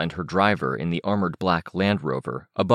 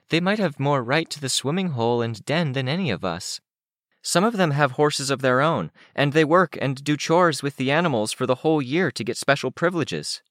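put-on higher, girlish character voice; Candy arguing back; 8 semitones higher than usual. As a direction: correct a friend in a raised pitch.

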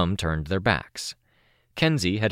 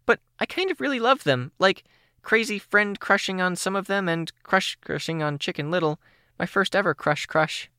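put-on high, bubbly teen girl voice; a much higher, chirpy young girl; bright young girl voice, a little shy; Kenzie's voice; 14 semitones higher than usual. Kenzie gushes.

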